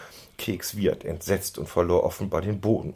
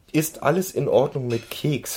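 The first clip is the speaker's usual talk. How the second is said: higher, mildly anxious question; worried; 6 semitones higher than usual. A narrator's reading. Boss asking after the fallen pony, worried. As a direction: ask with a touch of concern. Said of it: "ihre Stimme klang besorgt".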